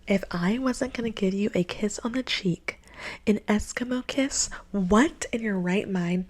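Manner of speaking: soft whispered line. Retold or imitated. Date whispering a butterfly kiss request, whispered. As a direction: hush it like a secret.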